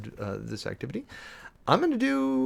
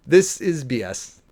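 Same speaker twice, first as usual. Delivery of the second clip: exasperated; 6 dB louder, slower than usual. Real, not acted. Exasperated verdict on policy.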